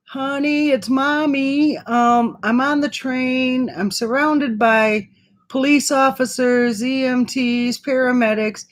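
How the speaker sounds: sugary calm voicemail to her son, masking panic; sweet mommy voice to son. Retold or imitated. Mother leaving her son a voicemail, high sweet mommy tone. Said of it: "And I said".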